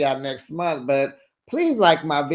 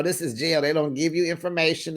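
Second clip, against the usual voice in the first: normal talking voice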